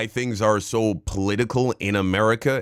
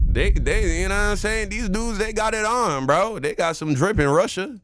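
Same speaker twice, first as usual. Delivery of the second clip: excited; 10 semitones higher than usual. Real, not acted. Excited praise of Russian drip.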